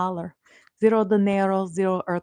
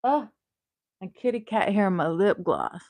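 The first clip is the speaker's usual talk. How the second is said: ordinary talk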